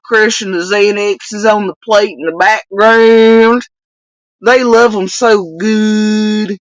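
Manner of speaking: loud, exaggerated country twang; mocking hillbilly imitation; mock Kentucky accent. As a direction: ridicule her with a hammed-up Kentucky accent. Mockingly imitating the clip's Kentucky speaker.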